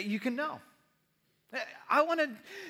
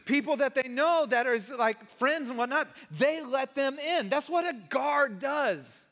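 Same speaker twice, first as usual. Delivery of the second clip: full-force preaching; 3 semitones higher, 5 dB louder than usual. Real, not acted. Pastor preaching about bodyguards to congregation. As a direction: preach it out with energy.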